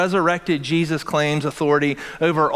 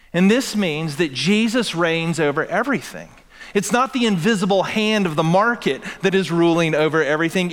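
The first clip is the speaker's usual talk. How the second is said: ordinary talk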